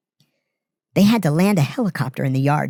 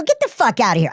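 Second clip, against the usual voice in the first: higher, scoffing disbelief; incredulous dismissal; 6 semitones higher than usual. Retold or imitated. Telling the inmate his story is nonsense, scoffing higher. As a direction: brush off his tall tale. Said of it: "I go".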